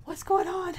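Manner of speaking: hammed-up startled parent. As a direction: pretend to jolt awake. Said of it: "you're gonna be like".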